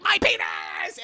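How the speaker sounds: high shouted yelp; mock pained yelp. Michael being electrocuted, high pained yelp. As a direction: yelp it high in cartoon pain. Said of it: "Michael's like".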